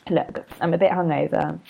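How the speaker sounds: normal talking voice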